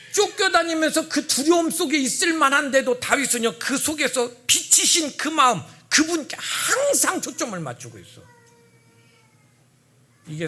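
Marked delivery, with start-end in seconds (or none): 0.1-8.2 loud, high preaching build; fervent pulpit voice; forceful preaching. Real, not acted. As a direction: preach it out to the congregation.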